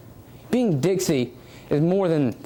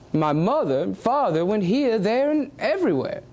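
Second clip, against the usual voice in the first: aristocratic English accent; 2 semitones higher than usual. Shown as a demonstration. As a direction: speak it like old aristocratic England, taking your time. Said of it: "specifically aristocratic England".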